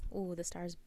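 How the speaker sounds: normal talking voice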